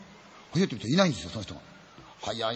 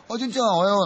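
cheery greeting; 9 semitones higher, 10 dB louder than usual. Retold or imitated. Cheerful cameraman greeting in morning.

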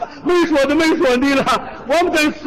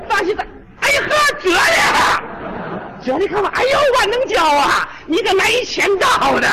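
shrill mock greeting; 7 semitones higher than usual. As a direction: call out in a put-on screech of surprise. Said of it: "什么口吻呢，这是？". (高声怪腔地嚷嚷)

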